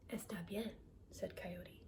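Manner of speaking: soft whisper; whispered coyote. Acted. Coyote agreeing to meet at night, whispered. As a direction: whisper the promise.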